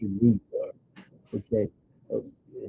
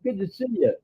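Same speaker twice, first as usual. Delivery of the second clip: excited greeting; 12 semitones higher, 4 dB louder than usual. Real, not acted. Waves to chat audience.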